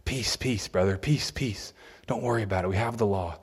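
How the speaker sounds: soft, placating mock reassurance; soothing false prophet. Mimicking scribes who soften the word, softly. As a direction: lull them, hush the worry away. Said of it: "softened the hard edges, saying".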